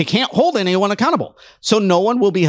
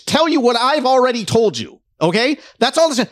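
ordinary delivery

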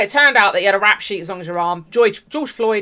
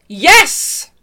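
excited yes; 6 semitones higher, 6 dB louder than usual. Real, not acted. Cheering a point, burst out.

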